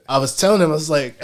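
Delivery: ordinary talk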